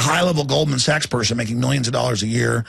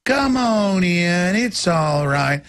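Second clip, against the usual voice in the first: high, slow sugary coaxing; sweet-talking handler; 9 semitones higher, much slower than usual. Retold or imitated. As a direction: lure them in sweetly, slowly.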